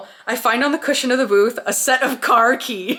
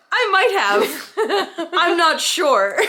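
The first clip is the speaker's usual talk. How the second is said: high, drawn-out mock uncertainty; coy teasing; 8 semitones higher, much slower than usual. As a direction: play coy, stretching each word way up high. Teasing dine-and-dasher with his keys.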